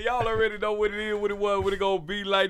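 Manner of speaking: hyped radio host announcing the show; radio DJ intro. Acted. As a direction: open it like a live radio DJ. DJ opening the show.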